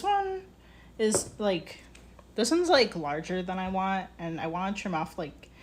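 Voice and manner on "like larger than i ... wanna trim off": ordinary talk, nothing marked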